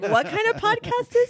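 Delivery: high-pitched incredulous mimicry; baffled listeners. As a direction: imitate a bewildered audience. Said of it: "They're gonna be like".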